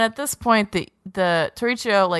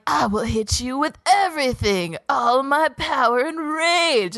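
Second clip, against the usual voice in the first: mock dramatic vow; 6 semitones higher than usual. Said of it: "Mob's like".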